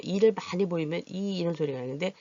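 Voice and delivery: normal talking voice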